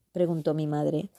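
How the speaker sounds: plain reading voice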